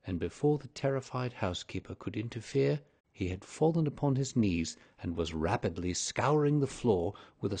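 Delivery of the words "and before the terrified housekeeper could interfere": ordinary delivery, nothing marked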